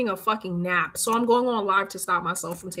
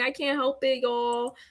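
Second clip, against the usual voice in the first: usual speaking voice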